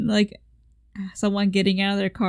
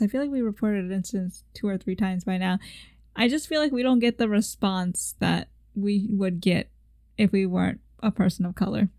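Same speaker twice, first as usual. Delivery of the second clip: normal talking voice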